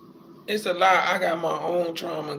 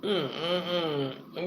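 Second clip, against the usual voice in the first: closed-mouth sob, soft; real tearful mm-mm-mm; overcome, humming through tears; muffled crying hum; 3 semitones lower, 8 dB quieter than usual. Real, not acted. Crying at the reunion.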